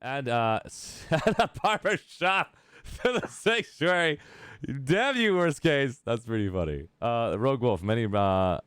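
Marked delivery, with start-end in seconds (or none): 0.9-4.2 high, slow, breaking into laughter; laughing while reading. Real, not acted. Streamer reading chat's barber shop question, laughing helplessly. 4.8-6.0 high, loud playful cursing; mock outraged cry. Streamer cursing a teasing chat member, mock outrage.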